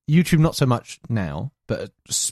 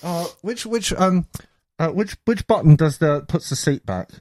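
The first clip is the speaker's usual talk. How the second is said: clueless pilot; 5 semitones higher than usual. Acted. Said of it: "the guy gets on and he goes".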